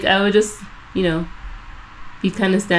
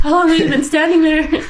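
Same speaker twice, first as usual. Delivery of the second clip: startled laughing question; 8 semitones higher than usual. Retold or imitated. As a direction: ask it still rattled.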